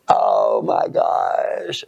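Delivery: very low, drawn-out groan; slow-motion sinking dismay. Retold or imitated. Retold dismay at being duped.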